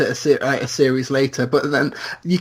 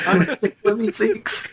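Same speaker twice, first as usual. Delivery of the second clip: Meeseeks voice; 7 semitones higher than usual. Retold or imitated. Quoting the Meeseeks.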